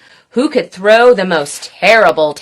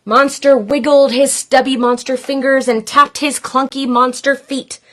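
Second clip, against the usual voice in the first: ordinary delivery, nothing marked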